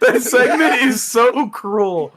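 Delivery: high, loud laughing complaint; laughing protest. Real, not acted. Player complains to host about the timed segment, laughing protest. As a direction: protest loudly through laughter, stretching the words.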